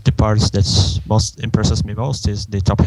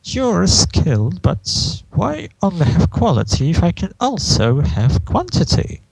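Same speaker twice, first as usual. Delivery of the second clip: Discord; 8 semitones higher than usual. Acted. Discord wants more scratching, high and theatrically greedy. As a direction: swoop up high on the last word. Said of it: "Discord starts".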